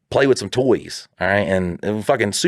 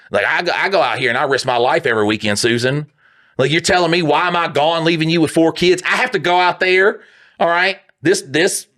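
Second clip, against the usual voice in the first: put-on pleading husband in a Southern accent, pitched up; Southern reenactor; 7 semitones higher than usual. Reenactor defending himself to wife Susan, indignant Southern voice. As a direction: raise the voice in self-important Southern protest. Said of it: "it hurts your brain how they speak out there".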